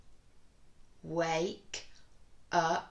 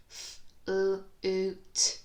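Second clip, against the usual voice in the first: F, l, oo, t run together faster; quicker sounding; 4 dB louder than usual. Shown as a demonstration. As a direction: speed up the sounds. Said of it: "Bit quicker".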